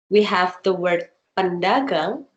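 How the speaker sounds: ordinary delivery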